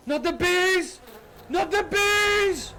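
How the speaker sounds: high, loud, hammed-up wail of panic; Not the bees meme. Meme quote shouted.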